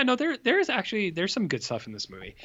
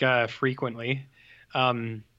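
ordinary delivery

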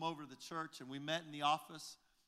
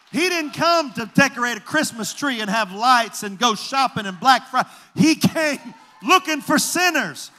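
much higher and louder, slow shouted preaching; preaching at full force; 9 semitones higher, 22 dB louder than usual. Acted. Pastor preaching to the congregation about sinners, shouting. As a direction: rise to a roaring, slow climax.

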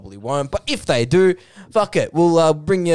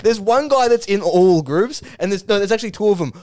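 ordinary talk

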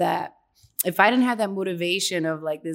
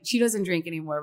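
usual speaking voice

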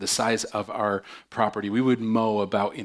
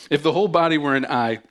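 rereads the line higher and louder after the slip; restart, louder; 5 semitones higher, 7 dB louder, faster than usual. Real, not acted. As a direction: start the verse over with fresh force, higher and louder. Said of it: "you need to pray for my wife".

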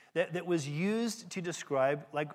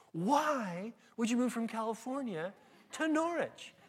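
higher, drawn-out disbelief; baffled local; 6 semitones higher than usual. Retold or imitated. Local asks preacher why he left California, incredulous. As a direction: imitate an incredulous local, stretching the question.

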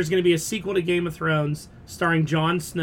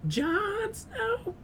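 falsetto; 7 dB quieter than usual. Pretend. Mocking Jon Snow.

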